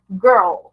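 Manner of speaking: put-on "Girl" said low and clipped as an English example; English word girl. Shown as a demonstration. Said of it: "Muitas pessoas não conseguem pronunciar girl". ("Girl" pronunciado à inglesa, grave e curto)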